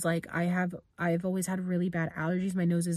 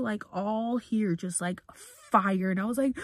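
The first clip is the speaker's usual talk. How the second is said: usual speaking voice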